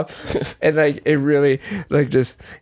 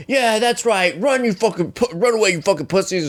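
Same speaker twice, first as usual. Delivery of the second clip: taunting; 6 semitones higher than usual. Retold or imitated. Imitates man's parting taunt.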